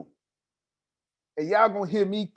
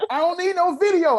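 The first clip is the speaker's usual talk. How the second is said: excited; 12 semitones higher, 5 dB louder than usual. Real, not acted. Hyped pitch about Clubhouse.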